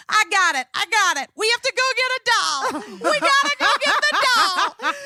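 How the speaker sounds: put-on very high, squealed child voice breaking toward laughter; hammed-up giddy, loopy child insisting; hysterical drunk kid. Mother imitating her child after anesthesia, hysterically giddy. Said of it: "she would laugh hysterically".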